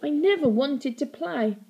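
put-on high, loud furious girl. A narrator's reading. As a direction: spit out in fury.

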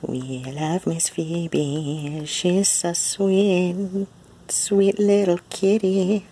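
high, slow sing-song coo. Real, not acted. Owner cooing baby talk to her cat.

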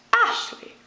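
"Ashley" with a high-pitched first syllable; "Ashley", stressed then unstressed. Shown as a demonstration. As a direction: punch the start of "Ashley", let the end drop. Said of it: "Ashley would have stressed, unstressed".

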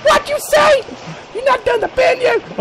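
very high, shrieking falsetto; mock angry wife. Wife telling him off about the bin, high falsetto scolding. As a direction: scold in a screechy put-on high voice. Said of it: "It's not my wife".

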